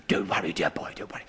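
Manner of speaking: soft, low whisper, tender; whispered comfort. Retold or imitated. Teacher whispering comfort to the frightened boy. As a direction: soothe him under your breath. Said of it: "He said".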